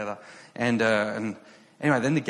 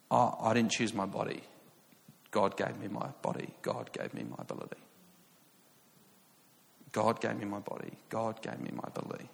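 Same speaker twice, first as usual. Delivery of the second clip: softly retold, understated humility; humble reply; 6 dB quieter than usual. Player answering praise after the win, quietly humble. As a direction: let it drop away softly, almost to nothing.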